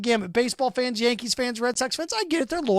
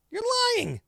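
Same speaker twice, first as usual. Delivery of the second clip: high mocking retort; 14 semitones higher, 3 dB quieter than usual. Real, not acted. Host calling out fans' empty threat, high and mocking. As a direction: jump way up, mock disbelief.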